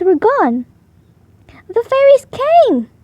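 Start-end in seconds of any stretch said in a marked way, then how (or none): 1.7-2.9 much higher, louder, slow joyful shout; excited cry. Acted. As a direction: burst out with delight at the discovery. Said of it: "I cried".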